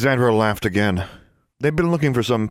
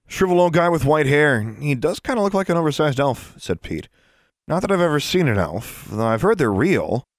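put-on higher-pitched, earnest kid voice; boy character chatting; 6 semitones higher than usual. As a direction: explain it as a young boy would. Voices Pete.

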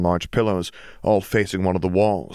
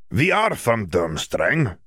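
put-on slow, heavy Eastern European accent; Bulgarian accent; 4 semitones higher, slower than usual. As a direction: speak slowly in Krum's Bulgarian accent. Krum corrects himself.

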